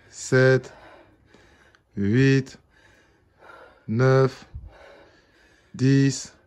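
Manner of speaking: loud, effortful counting through squats; doing squats. Real, not acted. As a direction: push each rep count out hard. Dix squats.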